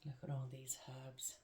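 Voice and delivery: soft awed whisper. Real, not acted. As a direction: breathe it out in wonder. Admiring herbs, whispered.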